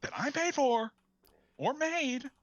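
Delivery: high-pitched, hammed-up indignation; mock outrage. Man defending his burger from an imagined thief, in mock outrage.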